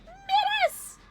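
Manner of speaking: very high, loud call of the name; excited shout-out. Real, not acted. Streamer thanking viewer Miris, high excited call. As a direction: sing out the name brightly.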